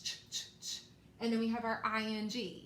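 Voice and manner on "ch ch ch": "ch, ch, ch" as bare voiceless sounds, no vowel; isolated letter sound. Shown as a demonstration. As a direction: repeat just the consonant sound "ch, ch, ch". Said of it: "We have our C H".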